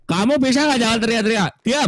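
hoger, strenge vermaning. Acted. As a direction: bijt het hem toe als agent. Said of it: "kan denger dia segitu".

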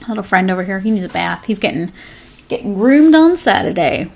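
ordinary talk, nothing marked

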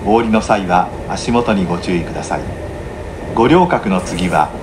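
ordinary talk

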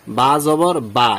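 drawn-out light "baa" for বা; correct open vowel. Shown as a demonstration. Teacher contrasting a normal letter, slow open "aa" in বা. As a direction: slowly end on বা with long "aa". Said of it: "এখানে ঠিক আছে".

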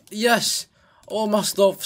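high, loud cheer; excited relief. Real, not acted. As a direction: burst out with a triumphant yes. Gamer succeeds, gets stuff back.

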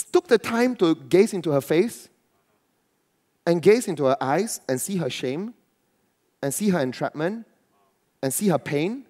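ordinary talk

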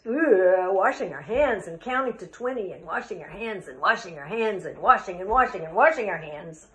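groan, then a fast, rhythmic chant; fed-up poem refrain. Acted. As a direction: rattle off the refrain like an endless weary chore.

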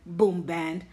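ordinary delivery

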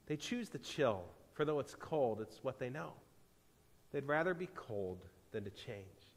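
soft, low, resigned answer; hushed, grave regret; somber explanation. A narrator's reading. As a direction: explain quietly with long pauses. Stranger sadly explains to her.

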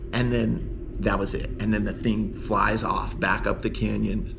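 normal talking voice

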